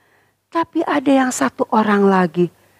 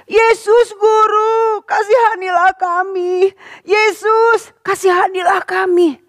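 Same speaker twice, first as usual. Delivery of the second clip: crying out; 8 semitones higher, slower than usual. Acted. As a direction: cry to Jesus from afar. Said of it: "Mereka berteriak". (seruan memohon belas kasihan)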